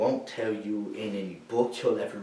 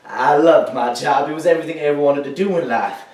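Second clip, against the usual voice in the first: fervent; 3 semitones higher, 8 dB louder than usual. Acted. Veteran proudly recalls loving his job.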